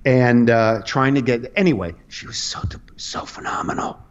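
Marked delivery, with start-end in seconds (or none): 2.1-4.0 breathy, voiceless whisper of wonder; awed whisper. Real, not acted. Comic recalling a gorgeous girlfriend, awed whisper. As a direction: whisper it, overcome with admiration. Awestruck about the woman he dated.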